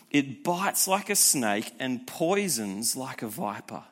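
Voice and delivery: ordinary delivery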